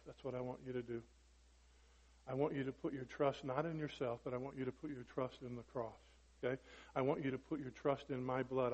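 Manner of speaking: gentle reassurance. Acted. Voicing Christ answering the believer.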